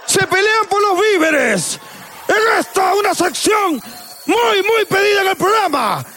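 voz aguda y fuerte de presentador; locutor de anuncio. Acted. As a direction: anuncia la sección con gran énfasis. Anuncio de sección del programa. (locutor de televisión exaltado)

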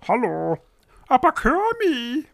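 high, cute, stuffy little bear voice; cute sick voice. Retold or imitated. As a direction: squeak it like a sick teddy. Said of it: "klingt man auch ein bisschen niedlicher".

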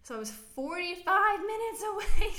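loud, high, laughing disbelief; exasperated. Real, not acted.

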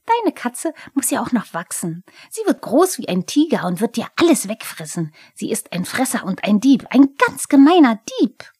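gloating, voice tipping over; loud, cracking kobold glee; Pumuckl. A narrator's reading. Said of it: "Pumuckls Stimme schnappte vor Genugtuung über".

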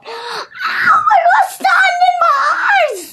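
loud, high wail of pain; Pinky whining. A narrator's reading. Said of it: "Pinky whined".

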